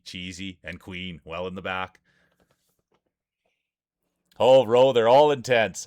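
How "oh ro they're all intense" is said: loud burst of excitement to a viewer; excited shout. Real, not acted. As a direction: call it out loud and thrilled.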